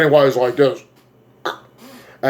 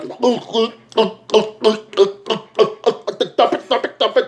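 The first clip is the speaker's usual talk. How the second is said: high, choked repeated pleas; shrinking clown; 10 semitones higher than usual. Acted. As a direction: squeak it over and over like a helpless creature. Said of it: "his face is like".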